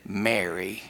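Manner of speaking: low, soft call of her name; tender naming. Retold or imitated. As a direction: say the name gently, like a quiet revelation. Jesus tenderly names weeping Mary.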